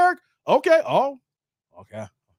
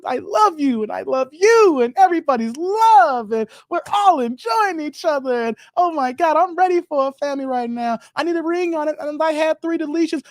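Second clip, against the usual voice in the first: higher, louder hammed-up gushing, piling it on; gushing fangirl mockery; 6 semitones higher, 3 dB louder than usual. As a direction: parody the sisterhood praise, high and over the top. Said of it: "It's like".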